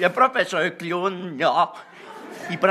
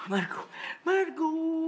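dazed slow call; 10 semitones higher, 6 dB quieter, much slower than usual. Acted.

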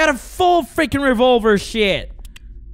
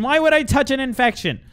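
ordinary delivery

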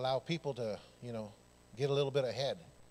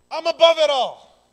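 soaring, much higher and louder cry; triumphant shout; 12 semitones higher, 16 dB louder than usual. Real, not acted. Preacher declaring victory to the congregation, triumphant shout. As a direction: proclaim it from on high. Preacher proclaiming heavenly vision.

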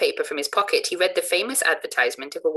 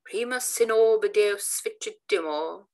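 vociferating; 6 semitones higher, slower than usual. Acted. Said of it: "vociferated a new antagonist".

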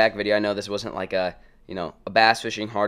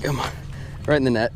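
ordinary talk

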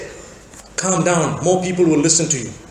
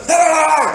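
mock fighting yell; 8 semitones higher than usual. Preacher imitating greetings that sound like fighting, high mock yell. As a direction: bellow a greeting as a wordless yell. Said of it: "as though they are fighting each other".

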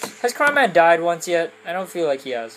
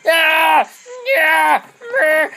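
random high yelps; 11 semitones higher, 6 dB louder, much slower than usual. Acted. Making random sounds.

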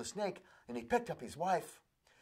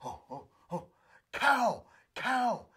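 high, crying mouse plea; frantic squeaky warning; desperate mouse; 7 semitones higher, 4 dB louder than usual. Acted. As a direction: close to tears, begging for help. Desperate mouse pleading cow.

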